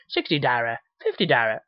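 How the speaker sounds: lightly put-on mock Chinese accent; a hint of mock Chinese accent; Shitty Wok guy. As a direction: do a silly cartoon Chinese accent. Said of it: "in that stupid dollar fucking accent".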